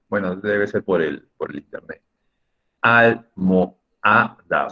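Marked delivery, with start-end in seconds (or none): 2.8-4.7 almohada in four slow, separated syllables. Shown as a demonstration. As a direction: split it as al, mo, ha, da with clear gaps. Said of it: "Son cuatro sílabas".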